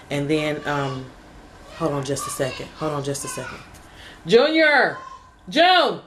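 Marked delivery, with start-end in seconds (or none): 1.8-3.6 quiet aside. Real, not acted. Breaks off recording for child. 4.3-6.0 high, raised call; sharp parental call-out. Real, not acted. Calls out to child.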